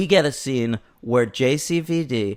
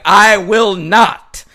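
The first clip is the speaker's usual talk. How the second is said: loud, slow, higher refusal; mock defiant refusal; 4 semitones higher, 7 dB louder, much slower than usual. Retelling his answer to her, loudly defiant. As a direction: dig in, every word drawn out.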